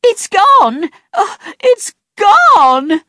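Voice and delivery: high wailing shout, the last words slow; screaming. A narrator's reading. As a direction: wail in panic over the lost bag. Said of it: "screaming and shouting".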